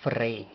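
"fray" with F run into a trilled R; pronunciation demo. Shown as a demonstration. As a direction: say "fray" as F, rolled R, EY. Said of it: "la forma que lo escribiéramos en español sería".

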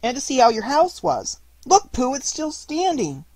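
high, loud, excited small animal; Piglet. A narrator's reading. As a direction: pipe up excitedly as a little piglet. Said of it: "said Piglet".

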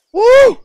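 very high, loud "woo"; loud whoop. Real, not acted. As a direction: whoop to let off steam. Lets off stress.

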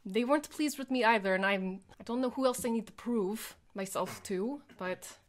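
ordinary delivery

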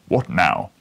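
loud, contemptuous question; insolent. A narrator's reading. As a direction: sneer it down at him. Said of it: "in a most insolent manner".

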